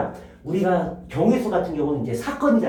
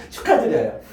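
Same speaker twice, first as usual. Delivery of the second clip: amused, higher, teasing congrats; laughing congratulations; 5 semitones higher than usual. Real, not acted. Teacher to students who forgot it all, laughing. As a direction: congratulate them through a laugh.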